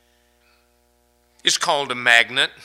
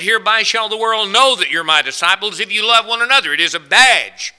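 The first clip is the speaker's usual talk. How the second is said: loud, raised-pitch proclamation; full-force preaching; 6 semitones higher, 8 dB louder than usual. Real, not acted. Preacher declaring love a badge of disciples, full-force preaching. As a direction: proclaim it strongly to the whole church.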